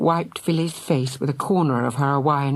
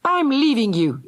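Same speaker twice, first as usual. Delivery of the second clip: mild yell; 10 semitones higher, 3 dB louder than usual. A narrator's reading. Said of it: "yelled Fergal at Philly".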